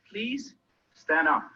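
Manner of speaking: ordinary delivery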